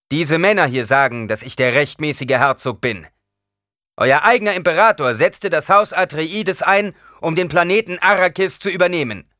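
loud, high, slowing to the end; proud proclamation. A narrator's reading. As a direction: claim the house with authority. Duke proclaims his right. (stolze Verkündung)

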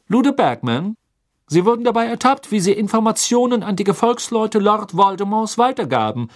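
loud, high official voice; formal stern accusation; stern judge. Acted. (strenger Richter)

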